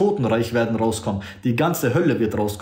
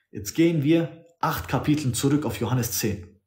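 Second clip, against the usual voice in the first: ordinary talk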